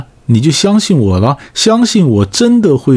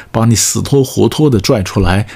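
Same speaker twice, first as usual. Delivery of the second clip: ordinary delivery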